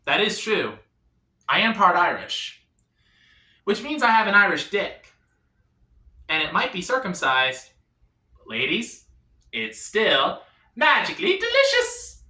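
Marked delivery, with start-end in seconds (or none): none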